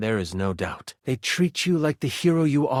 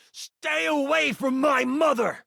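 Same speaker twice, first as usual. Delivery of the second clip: yell; 12 semitones higher than usual. Acted.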